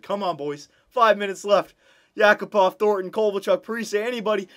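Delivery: high, loud desperate calling; urgent pleading. Real, not acted. Gamer urging his players in the final minutes, loud and urgent. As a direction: beg any player to score. Desperate late in game seven.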